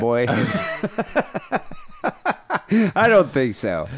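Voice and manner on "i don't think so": laughing. Real, not acted. Laughing at the question.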